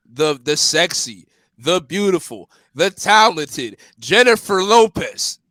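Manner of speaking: slow, drawn-out showman's build-up; grand, deliberate introduction; dramatic announcement. Real, not acted. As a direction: announce it word by word, swelling on the name. Presenting a name with drama.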